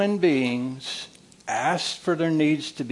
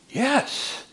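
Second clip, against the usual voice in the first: wife's relieved yes; 10 semitones higher than usual. Retold or imitated. Wife answering counselor.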